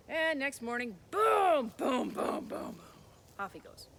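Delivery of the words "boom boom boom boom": booming, louder stomp sounds; giant footsteps. Acted. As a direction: stomp out the troll's footfalls. Troll's footsteps.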